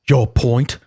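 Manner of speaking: mock British retort; short reply in a put-on British accent. Host reading Buckby's reply to Lewis, British voice. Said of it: "read Buckby's voice in a British voice".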